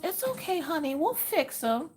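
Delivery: high, soft reassuring coo; lady's soothing coo. Retold or imitated. Optometrist reassuring the girl, high soothing voice. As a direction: soothe the child gently. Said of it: "she goes".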